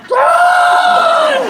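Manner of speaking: very high, loud shriek; panicked scream. Retold or imitated. Son on top of his friend, screaming in terror.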